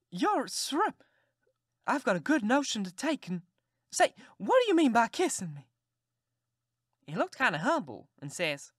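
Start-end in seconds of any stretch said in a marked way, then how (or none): 0.1-5.7 high, loud outraged scolding; a flustered aunt sputtering at an impertinent boy; indignant aunt. Acted. Aunt scolding the boy who kissed her, high and loud.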